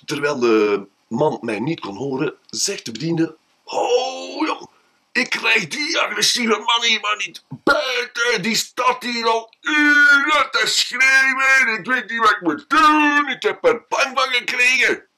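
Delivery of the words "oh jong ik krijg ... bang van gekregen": higher, frightened pleading shop clerk; anxious clerk begging for help; scared clerk. Acted. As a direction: plead like a rattled young clerk.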